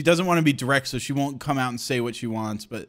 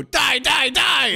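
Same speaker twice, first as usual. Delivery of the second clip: higher, louder mock battle cry; mock yell; 11 semitones higher, 4 dB louder, much slower than usual. Stick-figure driver yelling at the dead body, loudly. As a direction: yell it like a cartoon villain. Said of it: "just go".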